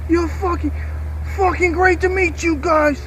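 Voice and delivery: high, slow mock Irish accent; Conor McGregor impression. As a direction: greet them in an exaggerated Irish voice. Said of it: "Like, Conor McGregor is, is Irish".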